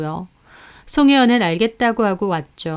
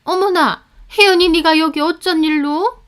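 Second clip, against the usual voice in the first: higher surprised voice; mother-in-law startled; 8 semitones higher, 4 dB louder than usual. A narrator's reading. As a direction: gasp of surprise at the visitor. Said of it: "놀라시더라고요".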